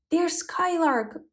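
high, drawn-out plea; calling out. A narrator's reading. Shepherd on the dragon calling a skylark, calling out. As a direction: call slowly up to a bird. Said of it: "Batya called the bird".

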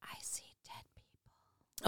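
slow, eerie whispered quote; spooky whisper. Acted. Co-host quoting after a ghost story, spooky whisper. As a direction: whisper it slowly like a creepy confession.

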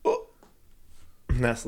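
ordinary talk, nothing marked

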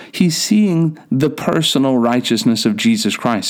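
ordinary talk

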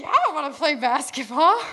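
high-pitched, relieved yes; retold eager acceptance. Retold relieved yes to Lee's invitation.

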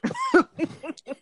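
a very high, loud shriek of laughter. Real, not acted. Host shrieking with laughter at the guest's dodge, loud. Guest says old enough.

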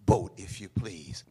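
ordinary delivery

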